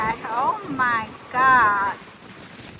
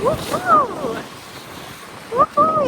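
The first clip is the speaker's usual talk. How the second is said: shaky vibrating voice; 13 semitones higher, slower than usual. Real, not acted. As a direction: voice trembling in the wind. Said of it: "Even my voice are shaking".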